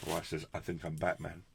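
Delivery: normal talking voice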